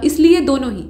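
normal talking voice

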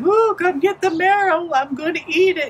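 high, singsong teasing voice; taunting. Acted. Lady with the bones teasing the chasing Coyote, taunting. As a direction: dangle it mockingly in a high-pitched voice. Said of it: "stop and taunt him".

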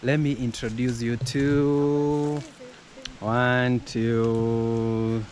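slow, loud drumroll build-up; dramatic announcer. Pretend. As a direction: announce it like a grand reveal. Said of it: "someone do the drum rolls".

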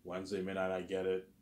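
usual speaking voice

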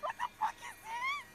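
high furious yell; raging gamer. Real, not acted. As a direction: scream it at the screen. Player yelling at game.

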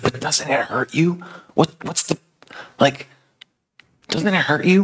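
high-pitched, incredulous questioning; bewildered concern. Acted. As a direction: ask haltingly, unable to understand the kindness. Acted bewildered question to Jesus.

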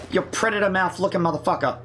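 usual speaking voice

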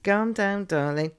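ordinary delivery

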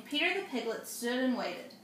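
plain reading voice

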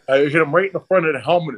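ordinary delivery

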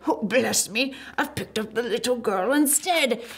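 muttering giant. A narrator's reading.

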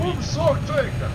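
shocked exclaim. Real, not acted. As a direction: blurt it in disbelief. Killed his own teammate.

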